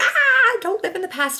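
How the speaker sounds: high, loud mock cry of don't; hammed-up warning shout; mock scolding. Scolding people stuck in the past, mock loud cry.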